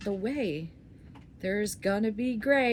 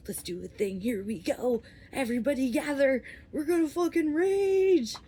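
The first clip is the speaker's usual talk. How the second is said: hyped-up soul; 5 semitones higher than usual. Acted. Soul rallying everybody to rage, hyped up. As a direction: build up into a rowdy party yell. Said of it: "my soul is like".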